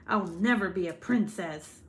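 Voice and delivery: villain. Acted. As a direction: declare it like a storybook villain. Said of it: "Now I really do sound like a villain".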